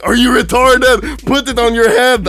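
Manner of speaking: father snapping at his confused son, loud; exasperated dad yelling. Retold or imitated. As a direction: scold him like a fed-up father. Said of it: "my dad is like".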